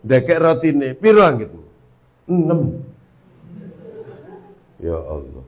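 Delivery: normal talking voice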